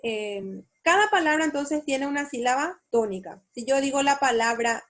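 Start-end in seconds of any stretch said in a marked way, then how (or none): none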